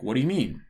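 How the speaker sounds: "What do you mean" rising to a peak, then falling sharply; questioning intonation. Shown as a demonstration. Repeating the example intonation for learners, questioning tune. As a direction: pitch swoops up and down across "What do you mean". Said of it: "into that intonation pattern".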